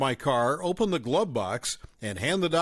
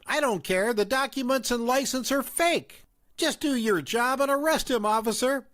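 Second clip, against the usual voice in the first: higher-pitched accusing mother; raised mom voice; 9 semitones higher than usual. A narrator's reading. Mom confronting the narrator at the fast food place, accusing. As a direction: play the suspicious mom, pitched up.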